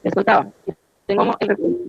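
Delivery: audio breaking up again on the call; cutting in and out like a weak radio; choppy connection. Real, not acted. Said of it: "ahí se escucha otra vez entrecortado".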